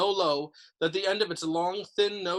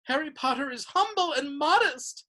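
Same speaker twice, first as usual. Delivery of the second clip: high, hushed elf worship; reverent elf; 10 semitones higher than usual. A narrator's reading. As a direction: speak of him with awe. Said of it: "said Dobby reverently".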